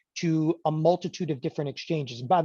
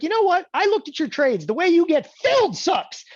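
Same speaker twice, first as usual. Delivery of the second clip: loud, high, heated comeback; retorting; 11 semitones higher, 7 dB louder than usual. Retold or imitated. Broker to customer griping about commissions, heated and loud. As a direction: fire it right back at the complainer. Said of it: "And I'm like".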